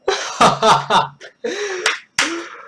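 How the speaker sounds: long high-pitched laugh; real high cackling laughter. Real, not acted. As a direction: burst out laughing. Cracks up after the story.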